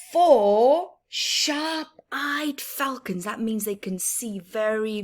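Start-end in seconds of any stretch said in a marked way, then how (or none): 0.1-3.2 slow, drawn-out read-aloud of four sharp-eyed falcons; storybook reading. Acted. Teacher reading the counting book to children, slow and drawn out. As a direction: stretch each word for the little ones.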